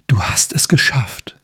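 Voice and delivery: cheering, but whispered; joyful hushed cheer; excited whisper. Acted. Mimi cheers Leo after saving the crystal, whispered joy. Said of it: "jubelte Mimi".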